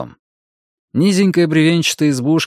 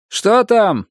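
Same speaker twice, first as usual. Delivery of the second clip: high, raised call from outside; 10 semitones higher, 3 dB louder than usual. A narrator's reading. Roger calling in from the street to Gunnar, calling out. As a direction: call in to your partner, pitch jumping up. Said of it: "крикнул с улицы Рогер".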